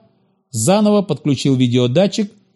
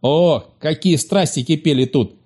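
ordinary delivery

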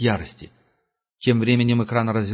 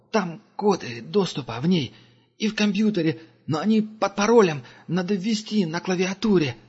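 high, timid explaining voice; a jittery technician pitched up; the captive explains the codes, high and timid; nervous angel; 8 semitones higher than usual. A narrator's reading.

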